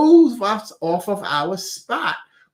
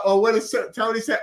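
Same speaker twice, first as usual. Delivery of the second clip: ordinary talk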